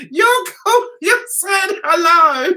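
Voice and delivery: mocking son. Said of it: "my son said to me".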